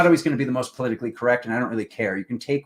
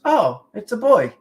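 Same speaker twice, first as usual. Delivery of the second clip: high and loud, a forced put-on cheer; disappointed mom; 10 semitones higher, 8 dB louder than usual. Mom hearing the news, acting high, loud and falsely cheerful. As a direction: fake delight that barely hides the letdown. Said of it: "My mom goes".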